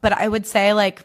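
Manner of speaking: ordinary talk, nothing marked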